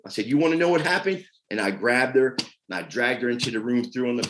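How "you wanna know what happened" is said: threatening. Retold or imitated. Retold fight with his partner.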